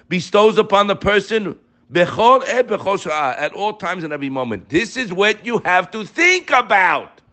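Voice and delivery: high-pitched, insistent sermon delivery; fervent preaching. Real, not acted. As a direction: preach it high, driving each phrase home. Preaches from quoted text.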